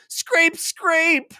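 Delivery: high, loud mock scraping noise; a high, loud playful scrape sound; sword scraping. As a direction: imitate a blade dragging on stone.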